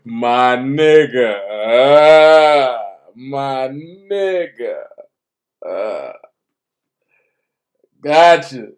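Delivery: low, slow, drawn-out movie-star impression; Denzel. Faking a famous actor's voice when lost for words, low drawl. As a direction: stretch it out deep and leisurely, with lingering "uh"s. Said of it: "I can't do that Denzel voice".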